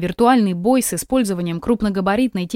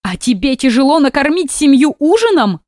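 tearful reproach; 5 semitones higher, 5 dB louder than usual. Acted. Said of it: "глаза заволокло слезами".

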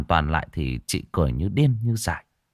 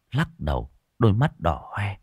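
ordinary delivery, nothing marked

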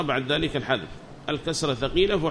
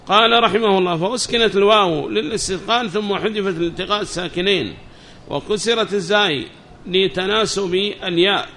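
higher, louder recitation of a book; reading text aloud; 3 semitones higher, 5 dB louder than usual. Acted. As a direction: read the text out to the class, raised and measured. (قراءة المتن بصوت مرتفع)

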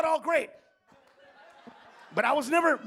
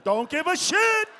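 defiant; 7 semitones higher, 7 dB louder, slower than usual. Acted. Comic repeating defiantly.

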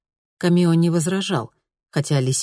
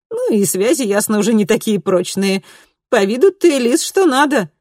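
high, loud, flirty vixen; 7 semitones higher, 5 dB louder than usual. A narrator's reading. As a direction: voice the brash vixen sizing him up. Fox voiced high and loud.

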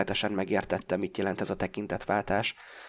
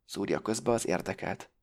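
ordinary delivery

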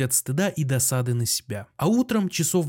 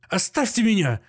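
higher-pitched outburst, acted; beside-himself cry; 7 semitones higher than usual. A narrator's reading. Doctor shaking with fury at his guests, beside himself. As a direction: cry out as if losing control. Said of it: "крикнул он не своим голосом".

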